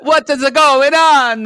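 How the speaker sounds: a startled woman demanding answers from her doorway; Italian woman. Retold or imitated. Woken neighbor yelling at the hallway: high loud slow outcry. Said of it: "I hear her say".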